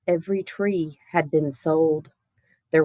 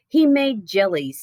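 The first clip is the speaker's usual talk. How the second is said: plain reading voice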